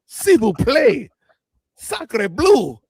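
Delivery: high, slow hammed-up French accent; french chef. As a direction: ham up a French chef, slowly. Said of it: "cussed them refs out in French".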